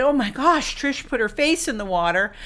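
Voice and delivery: high, loud cry of amazement; excited. Retold or imitated. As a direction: exclaim it in thrilled disbelief to the team. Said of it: "we were all excited".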